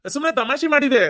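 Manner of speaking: high, falling sheepish excuse. Acted. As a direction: pass it off brightly as a joke. Says excuse aloud to uncle.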